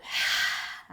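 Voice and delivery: big open-mouthed sigh out; loud sigh. Shown as a demonstration. Teacher showing the class a sigh, loud open-mouthed sigh. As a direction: let it all go through the mouth. Breath practice, exhale on fold.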